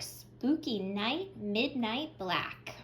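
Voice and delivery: ordinary delivery, nothing marked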